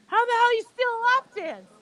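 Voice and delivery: high, loud imitation of disbelief; incredulous question. Retold man's incredulous question.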